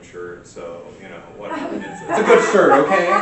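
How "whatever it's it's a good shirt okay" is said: loud, high, laughing self-defence; embarrassed laughing. Real, not acted. Presenter embarrassed at wearing the same shirt, laughing protest. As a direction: protest loudly through laughter.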